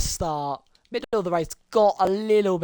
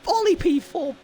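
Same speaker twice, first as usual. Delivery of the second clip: higher, fast excited race commentary; live play-by-play; 11 semitones higher than usual. Acted. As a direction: call the race as it unfolds.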